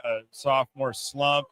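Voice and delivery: normal talking voice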